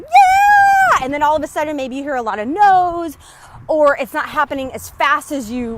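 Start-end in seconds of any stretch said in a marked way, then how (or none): 0.0-1.0 a big, much higher, louder whoop; excited yell. Retold or imitated. Coach acting out a viewer's high moment, shouted whoop. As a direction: cheer like you're on top of the world. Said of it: "you're like".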